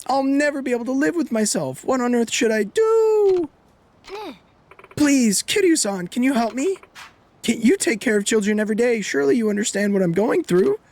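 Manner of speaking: hammed-up high mother, begging Kiryu for help; mocking the mother. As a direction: parody her overwrought begging. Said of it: "every single word that she says".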